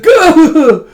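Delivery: high yelp with a laugh breaking through; strained yelp. Retold or imitated. Old man slipping on the log bridge, laughing yelp. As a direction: yelp as you slip on the logs.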